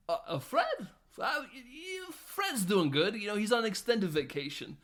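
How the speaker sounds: a hammed-up flustered Walken; nervous stammer. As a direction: fake a nervous cover story.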